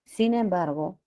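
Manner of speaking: usual speaking voice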